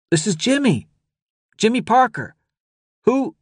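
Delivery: put-on very high-pitched young boy's voice; nervous boy. A narrator's reading. Sweaty-palmed boy answers the radio, nervous.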